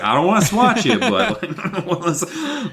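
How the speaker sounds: words broken up by giggles; can't keep a straight face; giggling while quoting a bee; laughing through it. Retold or imitated. Retelling bee's threat, giggling.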